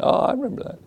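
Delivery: high, light mock "oh" of recall; mock realization. Disciples remembering Jesus' old command, high mock surprise. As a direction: play the dawning memory for laughs.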